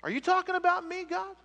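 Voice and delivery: high, rushed mock disbelief; incredulous Gideon. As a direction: squeak out your disbelief. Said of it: "Gideon-like excuses".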